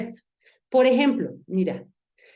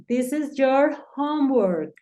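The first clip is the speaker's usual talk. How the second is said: slow English; 5 semitones higher, slower than usual. Shown as a demonstration.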